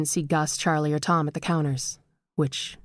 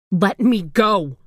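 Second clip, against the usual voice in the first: loud, slow desperate cry; 3 semitones higher, 6 dB louder, slower than usual. A narrator's reading. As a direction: scream to be released. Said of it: "she cried".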